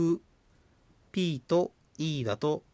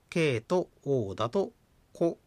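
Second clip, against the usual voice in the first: ordinary talk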